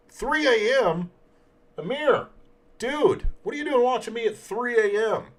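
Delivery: surprised. Real, not acted. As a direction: call out to him in loud surprise. Late-night viewer called out.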